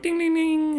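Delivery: very high sung ding ding ding; doorbell imitation. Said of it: "I'm like".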